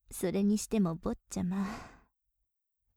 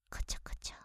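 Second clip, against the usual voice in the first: breathy tickling whisper close to the ear; teasing whisper; 5 semitones higher, 10 dB quieter, faster than usual. Acted. Maid teasing her young master, soft whisper.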